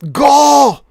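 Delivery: piercing high shout of go; desperate scream. Acted. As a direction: scream it at full force so they run. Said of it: "just screams".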